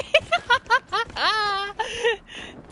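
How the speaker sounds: squealing giggle fit; long high giggle; high laughter. Real, not acted. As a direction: crack up at the name. Laughing at donkey name.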